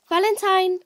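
loud call to the dog; calling the dog's name. Real, not acted. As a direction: call the dog from across the room.

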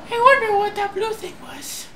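lifted voice for the hero; high character voice. Acted. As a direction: voice the hero's wondering line. Voicing character thought.

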